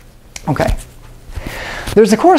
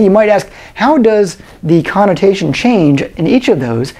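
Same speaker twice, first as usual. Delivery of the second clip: usual speaking voice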